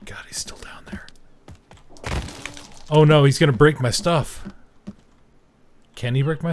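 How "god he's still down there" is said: hushed whisper while hiding. Real, not acted. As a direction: whisper so he won't hear. Hiding from the creature.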